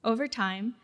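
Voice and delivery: normal talking voice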